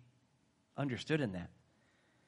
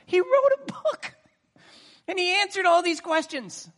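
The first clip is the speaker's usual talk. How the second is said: laughing exasperated shout; 8 dB louder than usual. Acted. Preacher repeating the punchline, laughing high shout. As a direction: burst out, laughing at how obvious it is.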